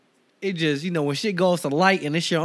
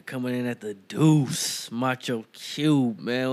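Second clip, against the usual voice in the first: slow, low announcer intro; podcast intro; 5 semitones lower, slower than usual. Acted. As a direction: roll out your name like a ring announcer.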